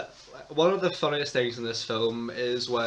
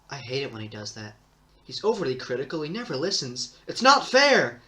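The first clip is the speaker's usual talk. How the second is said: Anakin; 2 semitones higher, 4 dB louder than usual. Acted. Co-host reels off Anakin's bad lines for a friend, mock tantrum. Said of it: "Do you want me to just say all his lines?".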